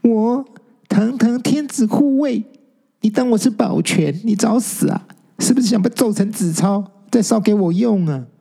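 put-on high, indignant ghost girl; angry. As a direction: bristle with high, proud outrage. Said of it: "生气地说". (高声生气地发飙)

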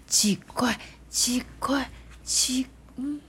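hushed whisper; mysterious whisper. Acted. Reading a spooky tunnel moment to the child, whispered.

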